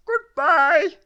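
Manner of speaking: very high, loud silly shout; shouted goodbye. A narrator's reading. Mister Muddle shouting at Miss Neat's door, high and loud. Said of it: "he shouted".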